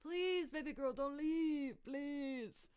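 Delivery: high, loud, begging wail; mock desperate pleading. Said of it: "thrashing and screaming".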